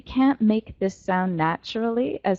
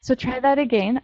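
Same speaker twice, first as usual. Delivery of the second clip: normal talking voice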